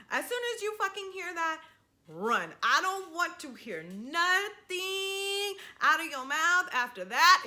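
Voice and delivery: normal talking voice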